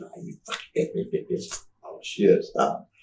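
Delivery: cursing under the breath, voiceless and slow; hushed cussing. Retold or imitated. Cussing at the girl as cops arrive, whispered.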